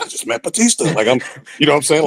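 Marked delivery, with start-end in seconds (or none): 0.0-0.8 higher, breathless excitement; starstruck. Retold or imitated. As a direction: gush like a starstruck fan. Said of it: "I'm flipping out".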